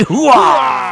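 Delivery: shouted gung-ho army bark; Al Pacino. Retold or imitated. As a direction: belt it like a gung-ho soldier. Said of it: "Al Pacino from Scent of a Woman".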